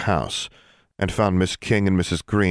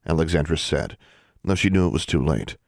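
plain reading voice

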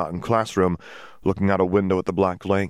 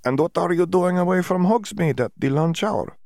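much higher, put-on foreign student voice; Dimitri's voice; 12 semitones higher than usual. A narrator's reading. Dimitri quizzing Hermione, voiced high and foreign. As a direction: play him as a cheery visiting student.

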